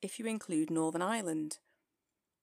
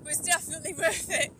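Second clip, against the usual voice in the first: high, loud and thrilled; exhilarated; 9 semitones higher, 3 dB louder than usual. Real, not acted. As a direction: gush with downhill excitement. Thrilled commentary on descent.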